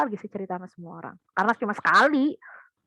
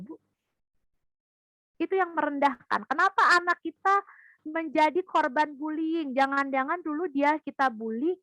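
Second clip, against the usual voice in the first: ordinary talk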